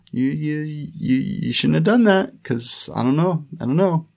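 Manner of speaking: halting, stammered objection; imitated confusion. Recapper voicing a confused Roddy, stammering reproach. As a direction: stumble through a mild reproach, unsure. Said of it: "just confused, doesn't know what to do".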